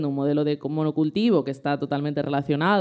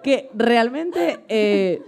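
giddy, high-pitched and laughing; babbling through nervous laughter; stressed; 5 semitones higher than usual. Real, not acted. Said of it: "esta eres tú con enerxía baixa".

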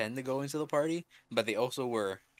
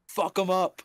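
rallying cry, higher and louder; cri de guerre imité; 8 semitones higher, 7 dB louder than usual. Retold or imitated. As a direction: yell it like a battle cry. Retelling his own battle cry.

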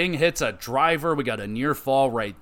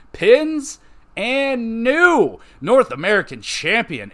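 excited call; 9 semitones higher, 5 dB louder, slower than usual. Acted. Calling the title change live.

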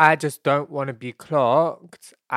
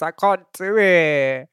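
loud, slow, higher-pitched complaint; exasperated; 5 semitones higher, 6 dB louder, slower than usual. Real, not acted. Host begging listeners for voice advice, exasperated. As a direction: plead helplessly, dragging the words out.

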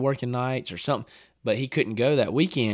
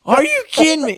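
much higher and louder, disbelieving; outraged shout; 9 dB louder than usual. Retold or imitated. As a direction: yell it in total disbelief. Outraged at dad's shot.

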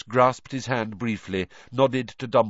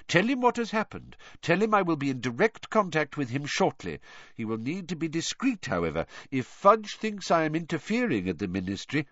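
higher-pitched, measured leader giving instructions; purposeful headmaster, pitched up; commanding old wizard; 7 semitones higher than usual. Acted. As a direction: lay out the orders in a lifted voice.

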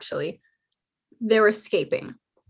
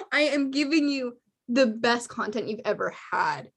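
ordinary delivery

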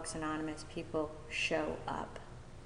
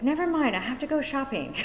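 high, loud retold brush-off; dismissive friend; 9 semitones higher, 7 dB louder, faster than usual. Friend dodging a question at Costco, dismissive. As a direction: imitate a friend waving the question away.

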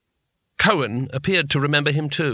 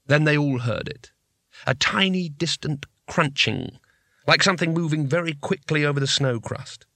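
usual speaking voice

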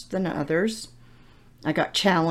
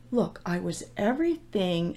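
ordinary talk, nothing marked

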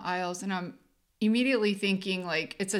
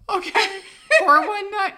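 higher, louder rattled number; flustered and louder; horrified; 11 semitones higher, 8 dB louder than usual. Retold or imitated.

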